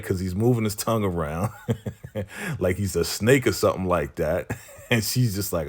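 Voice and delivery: talking through real chuckles; laughing. Real, not acted. Recapping his silly tongue moves, laughing. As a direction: break into laughter while recapping.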